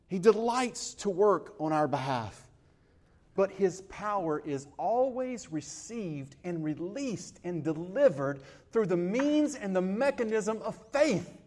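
loud, driving preaching through the verses; full-force preaching. Acted. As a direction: preach every verse with conviction.

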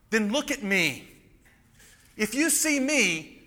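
high, forceful preaching voice as Jesus; impassioned Jesus. Acted. Jesus reproving Philip for still not understanding, impassioned preaching. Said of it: "He says".

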